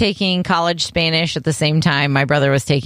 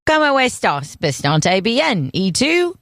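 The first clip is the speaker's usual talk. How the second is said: British accent, Spanish words, higher; 4 semitones higher than usual. Shown as a demonstration. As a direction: speak Spanish like a British toff. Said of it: "in a British accent".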